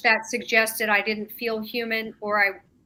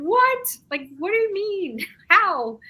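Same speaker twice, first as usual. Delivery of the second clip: retold shocked reaction with a laugh; startled disbelief; 9 semitones higher, slower than usual. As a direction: leap up in pitch, thrown by the news. Stunned reaction to being told fight evil.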